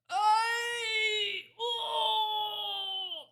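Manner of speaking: very high, loud drawn-out howl; roaring wail. A narrator's reading. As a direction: giant howling in a nightmare. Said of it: "roared the flesh lump eater".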